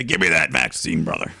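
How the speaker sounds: lightly done Hulk Hogan impression. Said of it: "he'll say".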